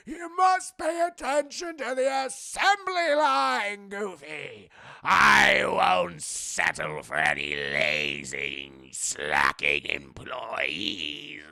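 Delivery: put-on loud, slow scolding old man; angry old boss. Factory boss scolding Goofy, slow and loud. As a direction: drag out the scolding like a cranky boss. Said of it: "has this old man who's like".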